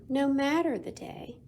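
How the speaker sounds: ordinary delivery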